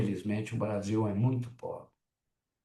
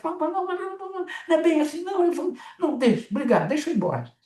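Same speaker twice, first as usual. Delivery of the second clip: high, rapid string of não não não; a much higher, hurried voice backing out of the talk; frantic high-pitched refusal, talking over everyone; rushed brush-off; 3 dB louder, much faster than usual. Retold or imitated. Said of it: "Aí ela começa".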